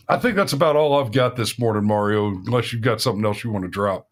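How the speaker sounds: ordinary talk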